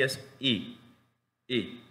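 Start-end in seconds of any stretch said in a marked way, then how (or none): 1.5-1.8 brief, barely articulated "I"; one quick "I" sound; short vowel I. Shown as a demonstration. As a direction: say "I" short, mouth almost still. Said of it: "Casi no articulo".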